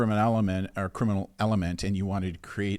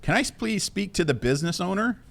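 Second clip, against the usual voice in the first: lightly voiced phone scammer, pitched up; scam caller voice; 10 semitones higher than usual. Host imitating a scam caller asking for the business owner, pitched up. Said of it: "That's usually what they say".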